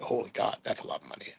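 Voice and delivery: a hushed, voiceless gasp at the price; shocked whisper. Retold or imitated.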